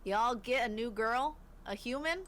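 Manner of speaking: clumsy Southern voice; bad Southern accent. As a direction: attempt a Southern accent, badly. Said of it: "my worst southern ac".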